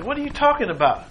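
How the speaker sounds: high, fast disbelieving question; incredulous king. Acted. Agrippa scoffs at Paul's words, fast and high. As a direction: toss it off like it's nonsense.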